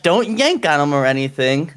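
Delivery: usual speaking voice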